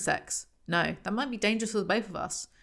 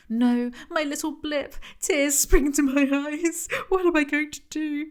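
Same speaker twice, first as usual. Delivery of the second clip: mock melodrama; 8 semitones higher, 4 dB louder than usual. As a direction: ham up the tearful heroine, half laughing. Mock-reading overwrought heroine's lines.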